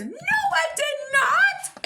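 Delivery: slow, loud high squeal; very high cartoon outrage; indignant denial. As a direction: squeal a drawn-out mock protest. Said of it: "Holden says".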